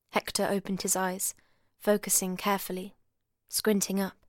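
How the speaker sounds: ordinary delivery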